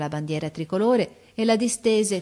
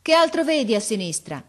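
loud, raised query; called-out question; 6 semitones higher, 6 dB louder than usual. A narrator's reading. Officer asks boy about the left, calling. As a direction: call it up to the lookout.

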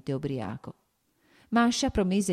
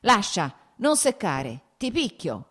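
sharp, raised-voice threat; angry shout; 5 semitones higher, 5 dB louder, slower than usual. A narrator's reading. Nikolai threatening Maria over the vodka, angry shout. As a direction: order them off in loud, high bursts. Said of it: "gridò".

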